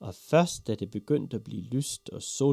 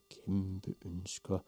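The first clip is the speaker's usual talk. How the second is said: soft, low muttering to herself; absent mumbling; 3 semitones lower, 7 dB quieter than usual. A narrator's reading. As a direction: mumble while leafing through a book. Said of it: "mumlede hun".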